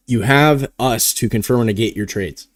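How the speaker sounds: ordinary talk, nothing marked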